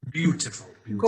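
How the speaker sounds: light mock Welsh accent; lightly imitating a Welsh accent. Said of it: "when we do an impression of anybody from Wales".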